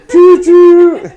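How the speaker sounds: high, loud playful choo choo; choo choo call. Retold or imitated. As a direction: sing out the train noise. Imitating chef's onion volcano.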